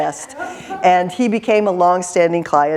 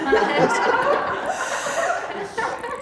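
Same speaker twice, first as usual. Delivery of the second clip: very high squealing laugh; high breathy laugh; delighted laugh; 5 dB quieter than usual. Real, not acted. Laughing after the punchline.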